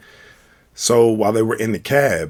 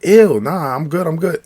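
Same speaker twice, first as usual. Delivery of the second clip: lightly voiced woman, higher and louder, brushing him off; a mock grossed-out woman saying no thanks; a hint of a put-off woman waving him away; dismissive woman; 8 semitones higher, 5 dB louder than usual. Women turning down a stranger.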